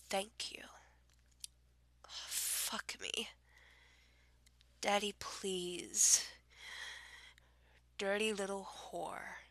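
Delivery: breathy, hushed dirty-talk whisper; sultry whisper. Pretend. Reading a dirty word list, she drops to a seductive whisper. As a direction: murmur it close to the mic like pillow talk.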